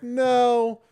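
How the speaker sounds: high, drawn-out no; emphatic refusal. Retold or imitated. Guest refusing the clerk's half refund, emphatic no. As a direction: throw the offer back in disbelief.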